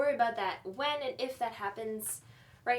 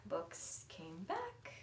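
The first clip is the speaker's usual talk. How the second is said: slow nervous whisper; 6 semitones lower, 12 dB quieter, much slower than usual. Acted. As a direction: breathe it out guiltily. Guilty evasive answer.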